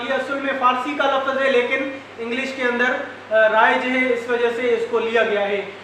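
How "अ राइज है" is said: normal talking voice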